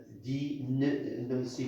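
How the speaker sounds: ordinary talk, nothing marked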